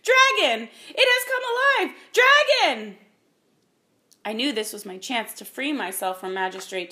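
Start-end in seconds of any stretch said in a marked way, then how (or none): 0.0-3.0 high, loud screams of panic; shrieked warning, full voice; screaming. A narrator's reading. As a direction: scream the alarm as terrified servants. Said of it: "heard the servants screaming".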